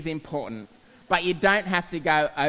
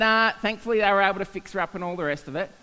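normal talking voice

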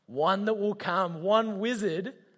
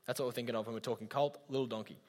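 quiet aside; 9 semitones lower, 11 dB quieter, faster than usual. Real, not acted. Quick aside explaining a word.

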